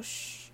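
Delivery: soft drawn "shh"; shushing. A narrator's reading. Harry trying to calm the crying elf, soft shushing. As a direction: hush someone gently. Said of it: "哈利企图一面说".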